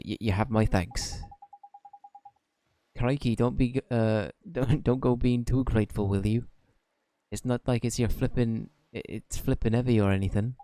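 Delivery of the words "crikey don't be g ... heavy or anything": put-on lightly Welsh-accented fairy sidekick, chatty; Welsh Drippy voice. Drippy chats to Oliver in a mild Welsh accent.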